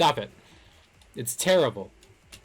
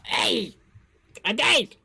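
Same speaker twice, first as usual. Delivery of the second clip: high, silly yelp; funny-noise voice; 5 semitones higher, 3 dB louder, slower than usual. Pretend. Gamer reacting to dying in a silly, high funny-noise voice. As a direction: squawk it out in short high bursts. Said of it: "I'm just making funny noises".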